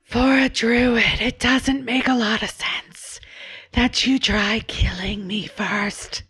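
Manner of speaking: shadow beast voice. Acted.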